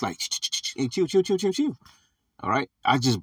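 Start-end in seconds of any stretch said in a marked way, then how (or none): 0.2-0.7 unvoiced hiss to silence a room; whispered hush; shushing. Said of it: "to hold their peace". 0.8-1.8 high, urgent hush; calm the room down fast; mock urgent shushing; hushing the crowd. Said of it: "beckoning unto them with the hand to hold their peace".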